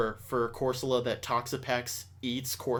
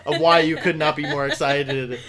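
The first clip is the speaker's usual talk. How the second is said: laughing tease; 4 semitones higher, 7 dB louder than usual. Real, not acted. Laughing while teasing guest.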